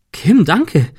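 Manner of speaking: overjoyed at the present; delighted thanks. Acted.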